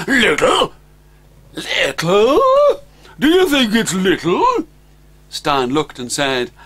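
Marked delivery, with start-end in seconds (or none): 0.1-4.7 put-on higher, louder, offended young dragon; a boy dragon taking offence; indignant dragon boy. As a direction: scoff at the idea it's little.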